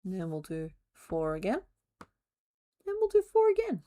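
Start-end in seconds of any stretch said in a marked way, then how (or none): 2.9-3.8 squeaky high voice. Pretend. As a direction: jump way up high on "And we'll do four again". Counting crochet stitches aloud.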